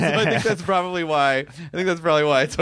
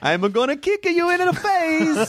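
high, drawn-out cartoon Italian; slow, threatening cartoon Italian, pitched high; mock Italian accent; 12 semitones higher, slower than usual. Cartoon chicken threatening a foe, slow high Italian accent. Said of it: "You just make him Italian".

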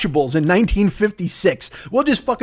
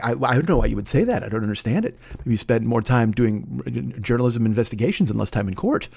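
much lower, softer, defensive; defensive Nathan Thurm; 9 semitones lower, 4 dB quieter than usual. Retold or imitated. Comic doing Trump as the evasive lawyer character, low soft deflecting. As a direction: turn it back on the questioner, low and quiet. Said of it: "He's all defensive and like".

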